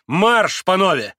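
loud, high command; marching order. A narrator's reading. Mitya herding the pans out, loud command. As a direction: call them to move.

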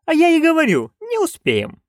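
high-pitched protest; complaining nanny. A narrator's reading. Nanny insisting they will be late, complaining.

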